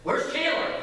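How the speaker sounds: high, hammed-up startled voice; imitated startled question. Napping friend wakes and looks for the child, mock startled. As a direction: blurt it high like someone just woken. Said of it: "he went just like this".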